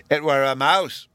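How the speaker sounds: lightly voiced little boy; small boy Bruno. A narrator's reading. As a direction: announce it as a small child would. Bruno announcing what he saw.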